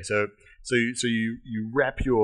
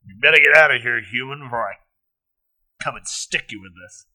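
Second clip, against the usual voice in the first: orc threat; 2 semitones higher, 12 dB louder than usual. Acted.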